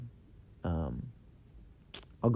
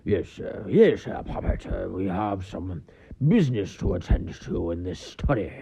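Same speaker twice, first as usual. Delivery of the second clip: hammed-up stuffy British accent; mock period-drama British accent, half mumbled; Ebenezer Scrooge accent; 3 semitones lower than usual. Comedian mimicking movie dialogue about business in the study, mock British accent. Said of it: "one of those Ebenezer Scrooge accents".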